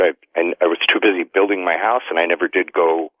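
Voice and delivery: ordinary delivery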